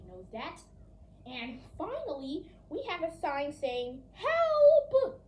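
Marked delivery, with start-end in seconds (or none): 4.1-5.2 high, loud desperate cry; cry for help. Acted. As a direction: shout it like a victim. Reading the door sign.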